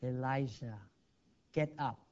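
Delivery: a tender, hushed call; gentle small voice. Acted. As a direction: speak as the still small voice. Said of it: "a gentle, small voice came".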